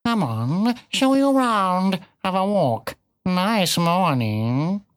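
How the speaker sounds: bitten-off words. A narrator's reading. Cat tersely answering the piglet, words bitten off. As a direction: bite off each short phrase. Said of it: "seemed to bite off its words and spit".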